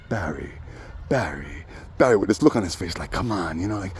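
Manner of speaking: hushed pleading. Retold or imitated. Imitating Dave pleading quietly.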